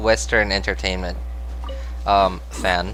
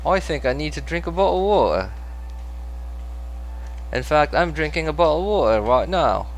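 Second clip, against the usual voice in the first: higher-pitched, exaggerated British accent; 6 semitones higher than usual. Shown as a demonstration. Streamer showing off an accent to chat, put-on British accent. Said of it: "my British accent".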